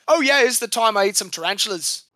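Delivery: lightly hammed Australian bloke telling a wild story; mock Australian accent, not quite landing; bad Australian accent. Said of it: "I can't do an Australian accent".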